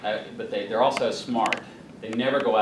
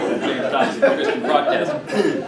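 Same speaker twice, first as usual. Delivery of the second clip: louder, breaking into laughter; laughing; 3 semitones higher, 7 dB louder than usual. Real, not acted. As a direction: laugh through the punchline. Laughing at his own joke.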